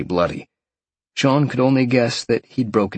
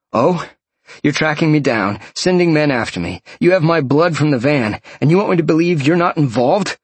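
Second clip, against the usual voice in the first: raised, accusing voice; angry interrogation; 7 semitones higher, 4 dB louder than usual. Acted. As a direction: demand answers, furious. Sean angrily interrogating captive.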